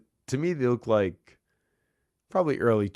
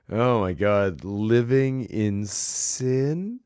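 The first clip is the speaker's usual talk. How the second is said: slow, drawn-out stunned disbelief; mock-scandalized; incredulous; 3 semitones higher, 6 dB louder, much slower than usual. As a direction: stretch out living in sin in shock. Incredulous retort.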